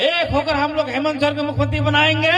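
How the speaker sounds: high, fast slogan chant; rally slogans. Acted. Leader leads election slogans for the crowd, chanting. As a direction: drive the crowd into shouting back.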